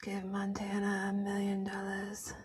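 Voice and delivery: hushed whisper, no voice. Acted. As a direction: whisper it like a secret. Playful aside to cohost.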